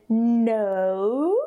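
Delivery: "no" stretched, gliding from o to oo; long rising English diphthong in "no"; diphthong glide. Shown as a demonstration. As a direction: draw out both vowel sounds in "no". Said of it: "you hear actually two different sounds".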